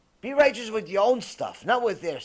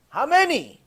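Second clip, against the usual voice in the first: how many, much higher and louder; outraged repeat; 8 semitones higher, 5 dB louder than usual. Real, not acted. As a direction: demand it again in outrage. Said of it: "repeated rhetorical".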